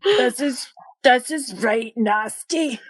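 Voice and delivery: gruff beastly gnoll. Acted. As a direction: growl it out like a gnoll. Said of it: "this is my gnoll voice".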